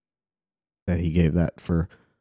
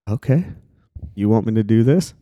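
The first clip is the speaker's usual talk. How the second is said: raised, louder eager question; 5 semitones higher, 5 dB louder than usual. Retold or imitated. Paul answering his calling, eagerly. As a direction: jump at the request, voice lifting. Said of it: "it made him eager".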